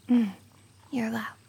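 normal talking voice

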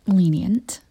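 sultry whispered bargaining; seductive whisper. Acted. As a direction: lean in and whisper the terms.

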